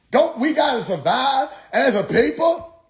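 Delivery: high, swooping mock-preacher question; imitated preacher challenge. Preacher rallying a doubter for his people, high and insistent.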